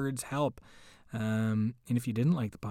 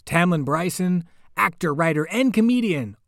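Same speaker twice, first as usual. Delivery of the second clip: loud, higher bright introduction; announcer enthusiasm; 6 semitones higher, 9 dB louder than usual. Real, not acted. Host hypes the reader for listeners, loud and high. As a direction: sell the guest's name with big energy.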